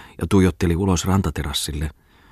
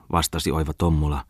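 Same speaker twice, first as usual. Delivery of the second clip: ordinary delivery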